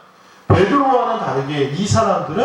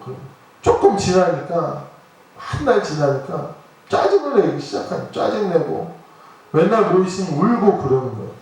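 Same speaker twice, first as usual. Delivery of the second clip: normal talking voice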